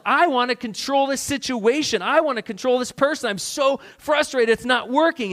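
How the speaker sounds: acted anger in prayer. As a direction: let the anger out as a demo prayer.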